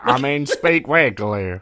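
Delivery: slow, hammed-up southern accent; worst southern accent. British guest mocking American hosts, worst southern accent. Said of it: "talk in my worst southern accent".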